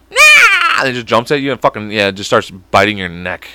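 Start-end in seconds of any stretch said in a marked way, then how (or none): 0.1-0.8 very high, loud shriek; puppet attack screech. Acted. Puppet jumps at your neck, shrill attack cry. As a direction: screech as you pounce.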